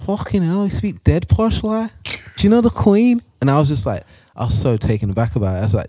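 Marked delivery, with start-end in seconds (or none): 0.1-3.2 high, slow Scouse teasing; mock Scouse girl. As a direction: tease him for sounding posh, in Scouse. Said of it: "and then she was like".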